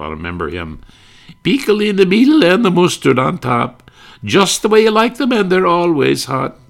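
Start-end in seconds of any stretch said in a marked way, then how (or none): 1.4-6.6 much higher, louder vendor chant; Mister Kitzel sing-song. Retold or imitated. Hot dog vendor chanting his pitch, much higher. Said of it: "Mister Kitzel".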